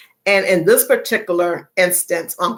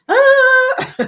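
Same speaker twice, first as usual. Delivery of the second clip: high startled yelp; 6 dB louder than usual. Real, not acted.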